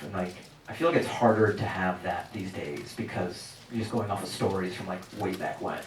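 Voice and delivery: ordinary delivery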